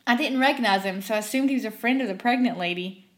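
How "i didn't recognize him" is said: usual speaking voice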